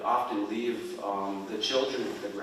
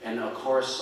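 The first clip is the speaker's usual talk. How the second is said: ordinary delivery, nothing marked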